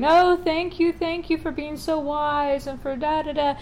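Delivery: a hammed-up, half-teary thank you; mock sobbing thanks. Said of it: "people are just sobbing".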